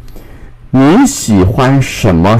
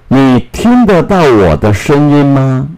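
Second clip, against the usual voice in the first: measured teacher's read, pitched higher; 5 semitones higher than usual. Shown as a demonstration. Teacher reads example sentence slowly first.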